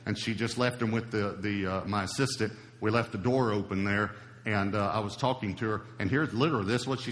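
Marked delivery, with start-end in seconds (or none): none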